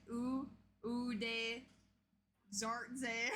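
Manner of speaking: slow, stumbling "U, u de zartze" with a z sound; mock fumbled Infernal. Player showing how Finley botches the spell, slowly. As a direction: garble the spell like a clumsy beginner. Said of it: "That's how Finley would say it".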